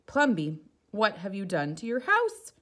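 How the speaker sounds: loud calling out to him; shouting neighbours. Acted. Neighbours shouting at Plumbeem over his house. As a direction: call across the street in outrage. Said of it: "the people shouted".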